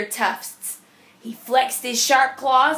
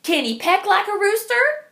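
bossy hen; 7 semitones higher than usual. Acted. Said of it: "said one bossy hen".

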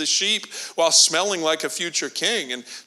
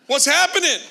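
mock excited greeting, rising higher and louder to the end; young brother bursting in with cheese; hammed-up party cheer; cheery excitement; 5 semitones higher, 5 dB louder than usual. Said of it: "he goes".

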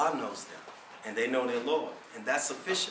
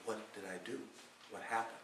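much softer, lower, inward question; quiet self-questioning; 8 semitones lower, 15 dB quieter, faster than usual. Pretend. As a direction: ask it under your breath, searching yourself. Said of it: "you must ask".

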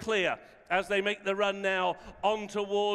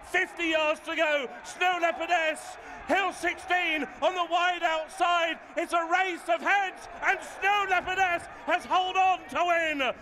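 excited race call; 5 semitones higher than usual. Real, not acted. Commentator calling the photo finish, rising excited shout.

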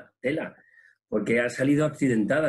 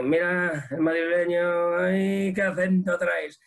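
teasing mock; 5 semitones higher, slower than usual. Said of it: "y de".

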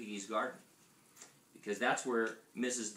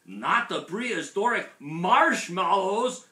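high, loud, drawn-out dismay; exclaiming; 8 semitones higher, 11 dB louder, slower than usual. A narrator's reading. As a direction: cry it out in hammed-up shock. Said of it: "exclaimed Skipito".

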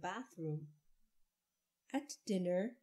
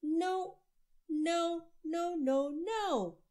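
high, loud string of no's; loud refusals; 9 semitones higher, 6 dB louder, much slower than usual. A narrator's reading. Child refusing the whole family, loud high no's. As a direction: shout each no like a stubborn child. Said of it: "Andrew said".

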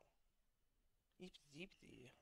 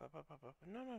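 usual speaking voice

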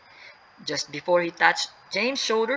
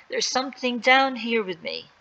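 higher-pitched scared reply; a touch of fear, pitch raised; mildly frightened; 7 semitones higher than usual. Acted. Sally in the dark cave, mildly frightened. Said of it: "replied in a shaky voice".

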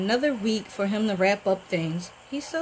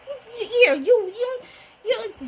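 much higher, halting mock sob; imitating tearful stammer; 11 semitones higher than usual. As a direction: stammer it out like she's about to cry. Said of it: "she went from all kinds of emotions".

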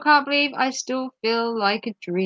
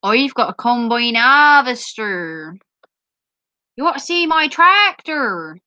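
proper Norfolk accent. Shown as a demonstration. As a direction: do a farmer's thick Norfolk accent. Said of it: "proper Norfolk sounds like this".

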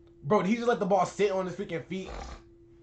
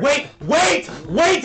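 excited wait; 5 semitones higher, 7 dB louder, much slower than usual. Real, not acted. Reacting to a skill move.

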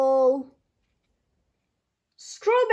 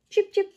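much higher little chirps; chick cheeps; 8 semitones higher, 4 dB louder than usual. Retold or imitated. Reading to children, high little chick cheeps. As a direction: peep like a tiny chick. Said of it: "Animal sounds".

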